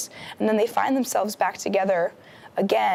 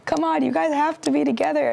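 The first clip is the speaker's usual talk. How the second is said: higher-pitched mock cheer; root for a couple, voice raised in pitch; cheering them on; 7 semitones higher than usual. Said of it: "cheering them on".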